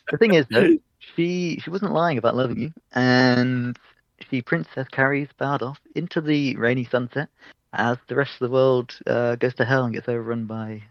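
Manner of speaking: normal talking voice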